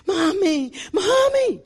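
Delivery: high, loud tearful cry for mami; crying daughter. Retold or imitated. Daughter crying out to her mother in the church, tearful high call. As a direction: call mami through tears. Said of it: "llorando, llorando".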